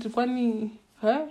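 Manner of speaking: ordinary talk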